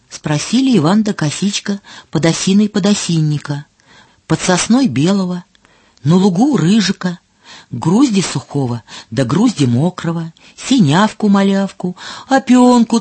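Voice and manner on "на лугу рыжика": ordinary delivery, nothing marked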